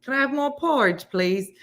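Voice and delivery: put-on high, pleading English orphan accent; Oliver Twist English accent. Host doing her Oliver English accent for chat. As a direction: beg for porridge in an English accent. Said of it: "all of my English accents sound like they're from".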